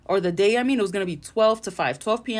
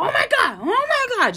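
much higher, louder whiny complaining; mock whining; 5 dB louder than usual. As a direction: parody someone moaning while they wait. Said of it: "just bitching and moaning".

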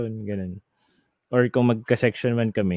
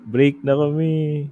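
slow sad news; 5 semitones higher, 6 dB louder, slower than usual. Retold or imitated.

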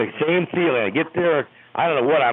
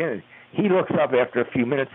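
ordinary delivery

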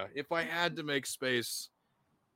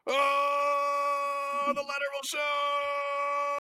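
high, slow drawn-out yell; show sign-off shout; 14 semitones higher, 5 dB louder, much slower than usual. Acted. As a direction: belt out the show name like an announcer.

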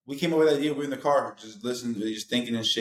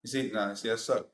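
normal talking voice

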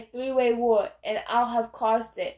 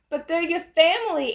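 higher, louder protest; 6 semitones higher, 4 dB louder than usual. A narrator's reading. Annabeth protests to Ares, higher and loud. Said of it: "Annabeth protested".

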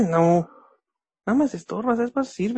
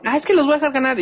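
higher, whiny mock of his friend; mocking imitation; 6 semitones higher, 3 dB louder, faster than usual. Friend mocking his rival's usual excuse, whiny higher mock. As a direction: mimic the excuse to ridicule it. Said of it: "porque siempre dice".

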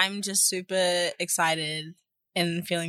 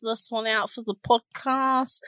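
put-on Jersey accent, Godfather style; 3 semitones higher than usual. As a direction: play a Jersey Godfather type. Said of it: "What, what accent was that?".

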